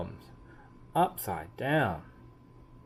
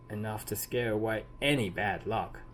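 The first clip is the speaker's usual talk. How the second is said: plain reading voice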